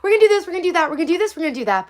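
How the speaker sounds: mock overexcited streamer hyping the crowd; mock hype voice. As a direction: ham up a bubbly cheerleader pitch. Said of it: "to be all like".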